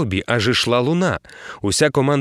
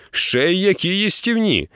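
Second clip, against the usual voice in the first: slow, lightly voiced sea captain; captain's voice; 5 semitones higher than usual. A narrator's reading. Captain reassuring hero about mermaid taste, slow relish. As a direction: savour the word like a gourmet.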